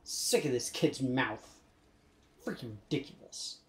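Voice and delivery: low, soft muttered complaint; grumbling aside. Real, not acted. Fed up with this kid's mouth, low grumbled aside. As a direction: mutter it under your breath, fading. Grumbling about the game's kid.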